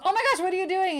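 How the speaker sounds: high-pitched mock alarm; startled protest. Woman to man lying on her in bed, mock startled. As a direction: protest playfully, voice shooting up.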